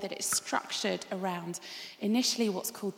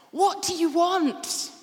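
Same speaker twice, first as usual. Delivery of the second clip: high, slow, loud calling out; loud question; 8 semitones higher, 8 dB louder, slower than usual. Acted. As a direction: boom the question out slowly. Jesus' question, climax.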